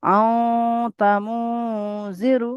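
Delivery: আও তামু জিরু with the আও sound carried through the nose; nasal ghunna recitation. Shown as a demonstration. Teacher showing students the nasal sound, chanting. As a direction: demonstrate the nasal hum on আও, then তামু জিরু. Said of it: "এই যে আওয়াজটা নাকে তুলে দিতে হবে".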